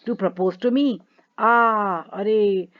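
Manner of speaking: a loud wail of dismay as she sinks down; half-acted cry, voice rising to an "aah". Natalia wails to her father that she lost the proposal. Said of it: "Falls into an easy chair and wails".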